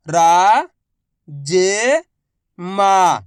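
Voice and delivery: very slow, each syllable drawn out. Shown as a demonstration. Teacher sounding out a word for pupils, slow syllables. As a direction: spell the word out slowly for a child. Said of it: "मैं किस तरीके से एक अक्षर का उच्चारण करके".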